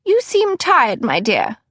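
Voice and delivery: put-on high, fussy older lady voice; fussy older cousin. A narrator's reading. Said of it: "Cousin Batilda observed".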